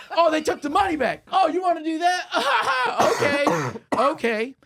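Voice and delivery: high, loud laughing mockery; laughing taunt. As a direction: laugh while daring them. Waiter taunting ladies, laughing.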